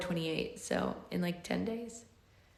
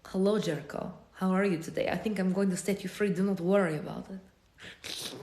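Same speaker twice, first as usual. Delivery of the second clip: sultry Russian. Acted. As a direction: purr it like a sultry Russian woman. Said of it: "a sultry Russian".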